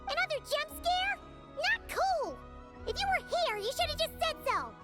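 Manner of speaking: high, swooping cartoon indignation; indignant scolding. Companion scolds friend for a jump scare, indignant. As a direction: scold in an animated huff.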